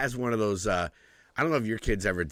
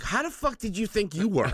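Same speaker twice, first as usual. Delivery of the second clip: incredulous retort; 12 semitones higher than usual. Retold or imitated. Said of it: "And I was like".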